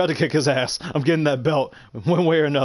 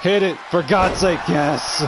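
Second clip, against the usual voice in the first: desperate, triumphant; 3 semitones higher, slower than usual. Real, not acted. Gamer finally landing the winning hit, desperate then triumphant. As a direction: plead with it at raised pitch, then celebrate.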